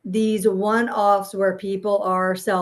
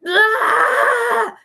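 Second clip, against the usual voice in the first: sudden high mock yell of frustration; angry yell; 14 semitones higher, 8 dB louder than usual. Parent imitating her own angry outburst at her kids, loud high yell. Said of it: "Sometimes I get angry and I'm like".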